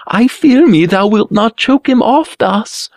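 high-pitched scornful taunt; sneering. A narrator's reading. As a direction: keep jeering in a high mocking voice. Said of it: "he sneered".

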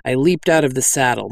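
ordinary delivery

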